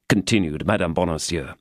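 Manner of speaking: ordinary delivery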